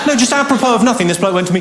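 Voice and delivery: ordinary talk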